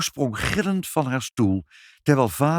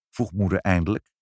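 usual speaking voice

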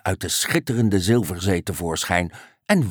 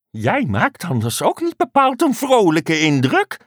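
high, pointed frog remark; 9 semitones higher, 4 dB louder than usual. A narrator's reading. Frog questioning the grumpy unicorn, high-voiced.

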